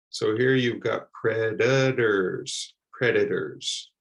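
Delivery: "predators" with the middle T said like a D; flap T. Shown as a demonstration. Teacher showing learners the American flap T. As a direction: soften the T in "predators" to a D. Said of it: "pronounced like a D".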